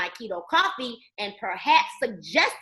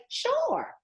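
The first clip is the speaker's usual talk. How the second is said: a high, brushing-off Sure; mock dismissive sure; 12 semitones higher, 5 dB quieter than usual. Imitating prospects fobbing her off, high mock Sure. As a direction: toss off Sure like you don't mean it. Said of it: "They're gonna say".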